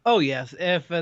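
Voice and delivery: normal talking voice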